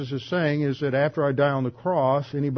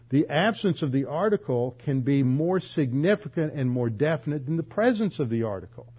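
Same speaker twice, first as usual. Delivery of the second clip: ordinary delivery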